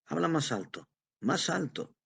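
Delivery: put-on high-pitched, querulous old man; testy, high complaint without shouting; irritated demand. A narrator's reading. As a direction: demand it twice, pitch jumping up. Irritated old man cannot hear his son.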